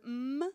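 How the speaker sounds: "m" as a long closed-lip hum; isolated phoneme. Shown as a demonstration. Teacher showing the m gesture, "m" hummed long. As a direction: sustain "m" as a pure hum, not the letter name. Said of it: "de la lettre M".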